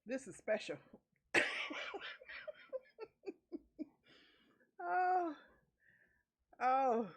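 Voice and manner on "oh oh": laughing hard. Real, not acted. Laughing at the story.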